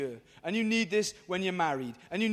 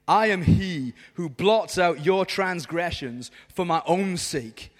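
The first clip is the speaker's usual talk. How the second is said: slow, lower-pitched reading of God's words; solemn scripture reading; 5 semitones lower, 4 dB louder, slower than usual. Acted. Preacher reads God's promise to the crowd, solemnly. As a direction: read it slowly and weightily. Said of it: "I'm gonna read it to you".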